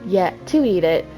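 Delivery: ordinary delivery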